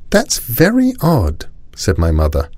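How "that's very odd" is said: puzzled. A narrator's reading. Mother puzzled at father's silence.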